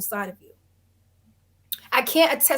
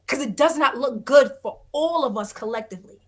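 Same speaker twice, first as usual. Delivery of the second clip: normal talking voice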